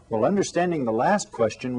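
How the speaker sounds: usual speaking voice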